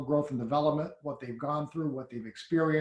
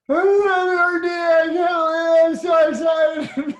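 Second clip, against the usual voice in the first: high, loud, slow shout of disbelief, close to tears; overjoyed tearful shout; 13 semitones higher, 12 dB louder, slower than usual. Retold or imitated. Speaking on the team call after hitting RD, an overwhelmed, tearful high shout. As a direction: blurt it out like you just won everything, half crying. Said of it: "I'm, like, crying like a baby".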